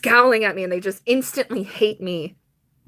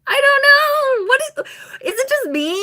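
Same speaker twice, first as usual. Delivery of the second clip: high, cracking voice fighting tears; real upset close to tears; near tears; 3 dB louder than usual. Real, not acted. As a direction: let the voice break as you say it. Upset over being kicked out.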